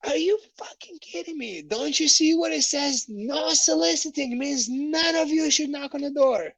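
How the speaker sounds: high mock rant at the salesman; imitating angry lady. The lady opens the door and berates the salesman, in a high mock angry rant. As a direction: scold like a furious homeowner. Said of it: "I was like".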